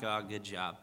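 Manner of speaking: low, soft, deflated mock congratulations; mopey. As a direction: say it like a sore loser sulking. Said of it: "I moped around for an hour".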